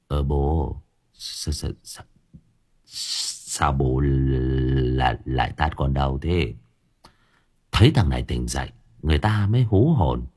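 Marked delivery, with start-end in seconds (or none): 0.1-6.5 low, soft, drowsy stammer; just woken and dazed; groggy. A narrator's reading. Sleepy boy complains to his father, groggy. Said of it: "lờ đờ mở mắt".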